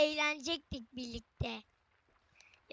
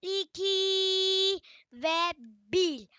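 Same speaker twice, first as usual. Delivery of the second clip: slow, high stretched count; milk the suspense of the countdown; teasing slow countdown; drawn-out countdown; 5 semitones higher, 5 dB louder, much slower than usual. Pretend. Dramatic countdown to subscribers.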